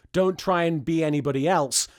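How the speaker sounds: ordinary delivery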